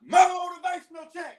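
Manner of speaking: loud gung-ho soldier. Acted. Military call and response.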